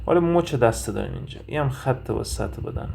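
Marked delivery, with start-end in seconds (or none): none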